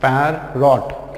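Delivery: "पैरॉट" slowly, "pair ... rot"; broken into syllables. Shown as a demonstration. Teacher demonstrating the break to learners, "पैरॉट" in two slow parts. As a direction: split "पैरॉट" with a gap after "pair". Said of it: "देखो कैसे यहाँ break करना है".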